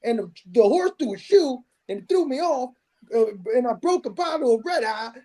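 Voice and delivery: high-pitched cowboy villain telling his grievance; hammed-up Southern outlaw impression; imitating Western outlaw. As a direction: argue like an Old West bully. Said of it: "He's like".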